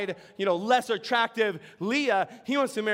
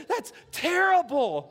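high, slowed fawn in dismay; Bambi voice; 7 semitones higher, slower than usual. Acted. Preacher voicing Bambi reacting to the owl's warning. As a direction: sound like a startled young fawn. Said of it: "And Bambi says".

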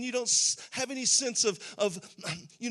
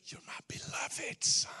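intimate whisper of a loving father; 4 dB quieter than usual. Retold or imitated. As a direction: breathe it softly, just for him. Father's affection voiced.

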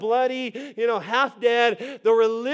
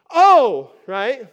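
fake surprise; 10 dB louder than usual. Pastor at the gas station pretends he just noticed the woman, loud fake surprise. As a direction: act startled as the woman reaches you. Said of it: "Tried to act surprised".

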